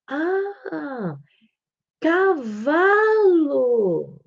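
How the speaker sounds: put-on high, very drawn-out reply to a toddler; exaggerated sing-song child-directed speech; adult's delighted recognition. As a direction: exclaim it slowly and loudly for a small child. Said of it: "Aí você olha, viu que ela apontou".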